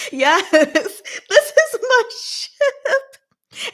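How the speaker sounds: high, slow, laughing delight; laughing gush. Real, not acted. Streamer agreeing with a viewer, laughing and gushing. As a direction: drag it out through laughter.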